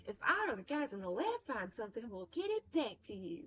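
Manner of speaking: put-on friendly, lilting Southern accent; swooping Southern character voice; Mary Sason. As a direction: put on a sociable Southern twang. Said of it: "She just sounds so friendly".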